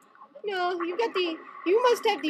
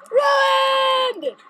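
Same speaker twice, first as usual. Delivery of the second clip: high, drawn-out cry of ruin; dramatic outcry; 7 semitones higher, 4 dB louder than usual. Real, not acted. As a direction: wail it like a catastrophe. Mock despair over game performance.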